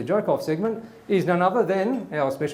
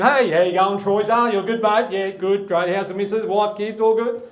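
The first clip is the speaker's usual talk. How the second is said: higher, louder matey greeting; hammed-up, raised silly voice; stupid put-on voice; 4 semitones higher, 5 dB louder than usual. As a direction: chirp a put-on voice at a workmate. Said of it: "I've got to put this stupid voice on".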